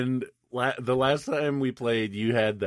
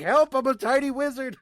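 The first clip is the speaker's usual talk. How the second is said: mock tiny wizard; 11 semitones higher than usual. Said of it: "'cause I'm like".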